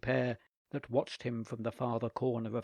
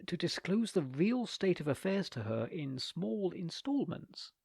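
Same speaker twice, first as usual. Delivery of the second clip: usual speaking voice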